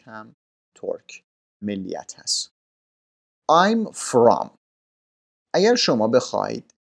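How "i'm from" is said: I'm from, from said firm and clear; stress on from. Shown as a demonstration. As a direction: lean on from in I'm from. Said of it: "کلمهٔ from رو حتماً باید بگید". (تأکید روی from در I'm from)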